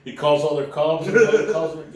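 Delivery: usual speaking voice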